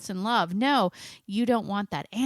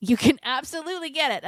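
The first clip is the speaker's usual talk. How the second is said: emphatic; 7 semitones higher, 6 dB louder, faster than usual. Real, not acted. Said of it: "emphatic encouragement".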